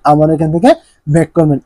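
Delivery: ordinary talk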